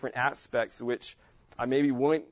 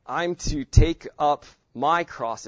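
my punched, slow and louder; stress on my; 6 dB louder, slower than usual. Shown as a demonstration. Teacher stressing my cross for the class. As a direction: lean hard on my. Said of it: "I'm emphasizing different words".

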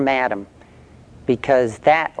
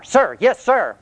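recruit's reply; 9 semitones higher than usual. Retold or imitated. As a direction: fire it back like a scared recruit. Recruit answers the drill sergeant.

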